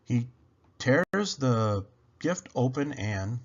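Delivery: ordinary delivery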